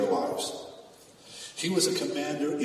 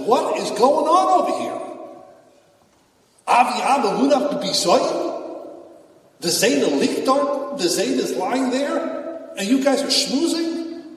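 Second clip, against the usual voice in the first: much higher and louder, indignant shout; outraged cry; 11 semitones higher, 6 dB louder than usual. Retold or imitated. Hushim shouting at the brothers over Yaakov, outraged. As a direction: demand to know how they dare chat beside the body. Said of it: "he pops up and he says".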